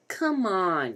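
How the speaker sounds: high, loud, drawn-out plea; pleading. A narrator's reading. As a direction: whine it insistently, falling at the end. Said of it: "he pleaded".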